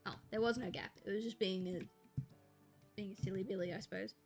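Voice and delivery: ordinary talk, nothing marked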